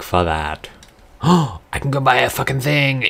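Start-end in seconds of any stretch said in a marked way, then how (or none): 1.2-3.1 excited. Real, not acted. Relief after boss death.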